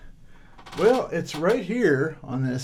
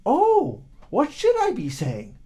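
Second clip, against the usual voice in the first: put-on high, louder simple boy's question; puzzled boy; 10 semitones higher, 4 dB louder than usual. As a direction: ask it wide-eyed and high.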